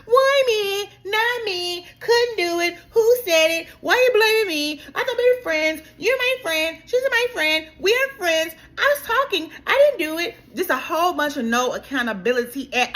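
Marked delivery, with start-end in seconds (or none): 0.0-10.3 mock whiny denial. Mocking a cast member dodging blame, high and whiny. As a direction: whine every excuse like a kid caught out.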